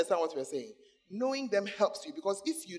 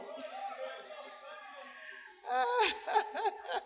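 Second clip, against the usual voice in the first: high-pitched, long laugh; real laughter at own joke; 14 semitones higher than usual. Real, not acted.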